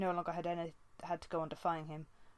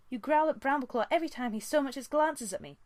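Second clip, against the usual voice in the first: higher and much louder, accusing; angry accusation; 8 semitones higher, 7 dB louder than usual. A narrator's reading. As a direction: throw the charge at her father. Daughter accuses father.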